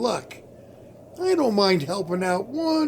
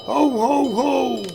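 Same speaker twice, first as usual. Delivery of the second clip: a lightly raised, hearty Santa ho ho; jolly Santa laugh; 4 semitones higher, slower than usual. Acted. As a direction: boom it out like a department-store Santa. [name] playing Santa on street.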